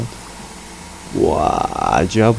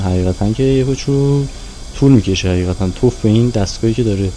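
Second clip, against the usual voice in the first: ordinary delivery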